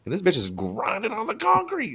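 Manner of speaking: high-pitched, scandalized retelling; retold incredulous outburst. Replaying what wife overheard him say, high incredulous outburst. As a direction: replay your own appalled exclamation in a high voice.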